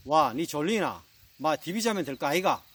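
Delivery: blunt Gyeongsang dialect retort; Gyeongsang husband. Acted. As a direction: answer your bride brusquely in Gyeongsang intonation. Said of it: "경상도 신부가 이야기합니다 ... 신랑이". (무뚝뚝한 경상도 사투리 남편)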